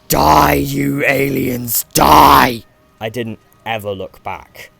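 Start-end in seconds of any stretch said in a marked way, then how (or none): 0.1-2.6 furious drawn-out scream. Acted. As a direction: roar it with everything you've got.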